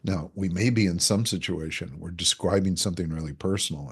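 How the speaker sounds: usual speaking voice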